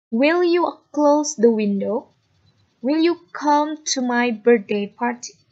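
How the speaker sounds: slow, clear sentences for the class to repeat; slow model reading to repeat. Shown as a demonstration. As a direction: say each line slowly so students can copy it. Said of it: "Listen to me carefully". (ucapkan perlahan dan jelas untuk ditirukan)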